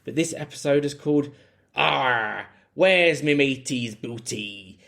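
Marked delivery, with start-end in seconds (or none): 1.7-4.8 hammed-up West Country pirate growl, slow; awful pirate accent. Host announcing the episode title in a mock pirate accent. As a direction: drag out the title like a cartoon pirate. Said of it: "nor the awful accent coming up".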